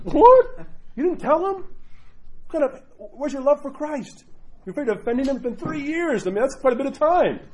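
sharp high what then scolding disbelief, pitch far up; shocked disbelief. Retold or imitated. Priest scolding a convert who hid baptism, shocked. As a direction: cannot believe they hid it. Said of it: "I was like".

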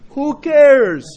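loud, high, drawn-out shout; emphatic dismissal. Real, not acted. Preacher dismissing his basketball boast, loud and emphatic. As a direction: throw the question out at full volume.

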